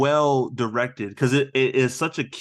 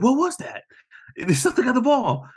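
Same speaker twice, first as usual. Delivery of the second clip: high, alarmed mock outcry; alarmed retelling; 11 semitones higher than usual. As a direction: blurt it out in imitated panic. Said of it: "He's like".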